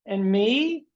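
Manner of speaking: mock needy inner voice. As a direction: beg for attention like a jealous child. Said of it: "your inner voice going".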